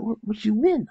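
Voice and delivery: high, loud astonished question; surprised mom. Retold or imitated. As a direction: mom caught off guard. Mom's surprised reply.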